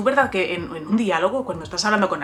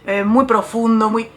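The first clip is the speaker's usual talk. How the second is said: louder, hammed-up grandiose emphasis; mock pompous; 2 semitones higher, 8 dB louder than usual. Writer mocking her beginner style, mock pompous. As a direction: puff up each word with mock grandeur.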